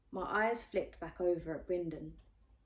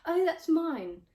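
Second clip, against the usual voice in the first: much higher, louder and slow, showing off; 10 semitones higher, 9 dB louder, slower than usual. A narrator's reading. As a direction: stretch it out to be noticed. Pupilla claims her order flirtily.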